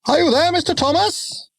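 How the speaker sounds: calling out high across the station; shouting. A narrator's reading. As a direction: driver calls out for the station master. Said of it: "shouted Jones the steam".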